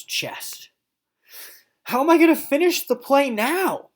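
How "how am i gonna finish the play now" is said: high, loud frantic worry; despairing. Acted. Mister O fretting over the broken sock puppet, high and frantic. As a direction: panic over the ruined puppet. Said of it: "Mister O thought to himself".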